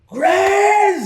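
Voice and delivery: high, loud, long-held yell; full-lung shout. Acted. Halfling calling the goblin upstairs, top-of-the-lungs shout. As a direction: holler the name up to someone out of sight. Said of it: "shouts at the top of his lungs".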